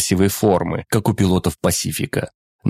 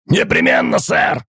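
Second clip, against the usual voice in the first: shouting; 6 semitones higher than usual. Acted. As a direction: yell it like a soldier. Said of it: "Да не ори ты так".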